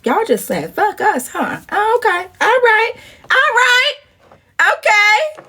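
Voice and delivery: high-pitched put-out sarcasm; sarcastic. Retold or imitated. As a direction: let the okays climb in mock disbelief. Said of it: "I was like".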